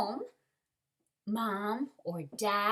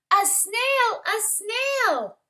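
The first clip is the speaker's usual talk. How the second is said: high, loud cry of discovery; excited exclamation; 13 semitones higher, 9 dB louder, much slower than usual. A narrator's reading. Children spot the snail in school, high excited cry. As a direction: pitch it way up, astonished.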